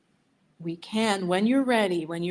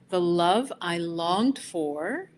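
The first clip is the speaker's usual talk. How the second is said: slow affirmation; 4 dB louder, much slower than usual. Shown as a demonstration. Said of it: "you just wanna listen the first time".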